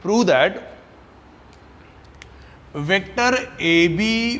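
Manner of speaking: very slow dictation with long pauses between words; slow classroom dictation. Acted. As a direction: dictate it so the class can write along. Teacher dictating an example.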